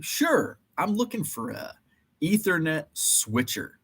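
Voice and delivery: high hushed customer voice; mock polite customer. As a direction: play yourself overly polite. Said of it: "Asked the guy at the front".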